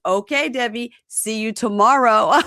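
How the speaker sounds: mock-cheery singled-out goodbye; teasing farewell. Groom joking to his bride as she leaves, teasing farewell.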